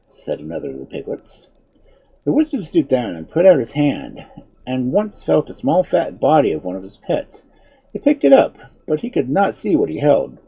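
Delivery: usual speaking voice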